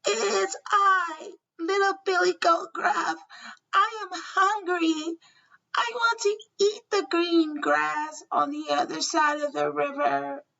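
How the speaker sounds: tiny, high-pitched little goat; small goat voice. A narrator's reading. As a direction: squeak it out like the littlest billy goat. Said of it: "answered in his small Billy Goat voice".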